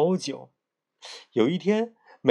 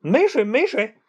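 quick, higher cutting-in; hasty interruption; 8 semitones higher, 4 dB louder than usual. A narrator's reading. 石猴急忙打断. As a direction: stone monkey brushing off their questions, eager. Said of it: "连忙打断说".

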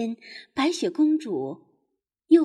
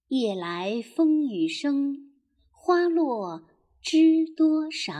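一字一顿地慢慢吟诵古诗; 像给孩子示范背诗那样拖长节奏; 慢速诗歌朗诵; slower than usual. Acted. 慢速朗诵唐诗. (缓慢地朗诵古诗)

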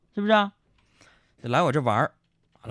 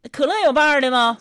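high, loud, insistent question; pushy show-off customer; 11 semitones higher, 6 dB louder than usual. Retold or imitated. Diner asking for vintage cola, high insistent demand. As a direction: demand it loudly, putting on airs.

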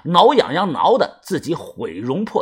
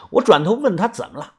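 plain reading voice

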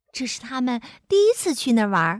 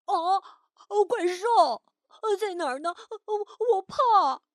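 frightened squeal; 7 semitones higher than usual. A narrator's reading. Green monster panics at hearing "monster", frightened squeal. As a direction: squeak in little scared bursts. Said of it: "吓得尖叫了起来".

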